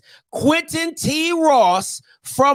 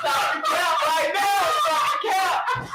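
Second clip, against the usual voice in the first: laughing shout; 3 semitones higher, 5 dB quieter, slower than usual. Real, not acted. Host laughing at the idea of the new coach. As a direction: cackle it out in disbelief.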